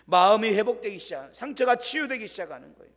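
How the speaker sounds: ordinary delivery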